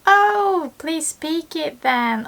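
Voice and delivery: high excited Oh, then a slow please speak it then; mock a relative begging you to show off; eager family request. Family member asking the learner to speak Japanese, high and eager. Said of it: "your friend or family would definitely say".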